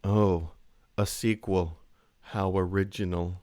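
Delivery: deadpan low sarcastic drawl. Retold or imitated. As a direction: say it dripping with sarcasm. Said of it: "her delivery of".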